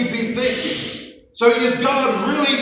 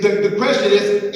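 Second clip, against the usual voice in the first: ordinary delivery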